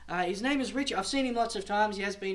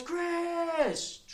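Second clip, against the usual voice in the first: long high call of a name; retold calling out; 11 semitones higher than usual. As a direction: imitate a high, drawn-out holler. Man calling out from bush.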